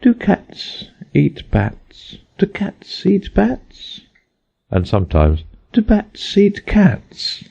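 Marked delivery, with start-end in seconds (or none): 0.0-4.0 very slow, drowsy sing-song; dreamy. A narrator's reading. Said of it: "in a dreamy sort of way". 5.7-7.4 very slow, drowsy sing-song; dreamy. A narrator's reading. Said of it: "in a dreamy sort of way".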